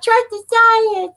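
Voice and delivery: high slow sarcastic slogan; high slow mock of the slogan.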